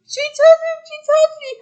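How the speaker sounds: very high, loud mock scream; imitated panicked scream. Mike retelling being touched by the little girl, mock screaming. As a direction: shriek it in a squealing imitation of Mike. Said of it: "Why are you screaming?".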